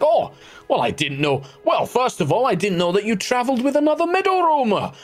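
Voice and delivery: high, effusive character voice; high sing-song welcome to one of his own kind; surprised centaur host. Acted. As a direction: exclaim in pleasant surprise, pitched well up. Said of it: "he's like".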